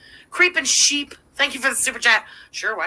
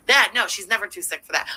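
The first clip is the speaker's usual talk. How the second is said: ordinary delivery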